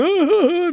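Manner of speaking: high drawn-out mocking hoots; mocking jeer. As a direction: imitate a crowd making fun. Friends mocking him, imagined.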